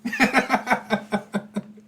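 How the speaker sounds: high burst of laughter; a run of quick, high chuckles. Real, not acted. Game master laughing at the Epiphany mix-up, high laughter.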